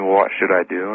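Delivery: normal talking voice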